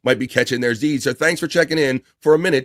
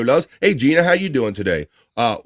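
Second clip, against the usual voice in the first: ordinary delivery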